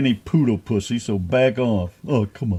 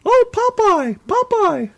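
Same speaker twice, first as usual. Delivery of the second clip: very high cartoon falsetto; Olive Oyl-style girl; slower than usual. Host shows which girls he prefers, high cartoon falsetto. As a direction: squeal for Popeye in mock-girlish falsetto. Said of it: "Is that how you like 'em to sound?".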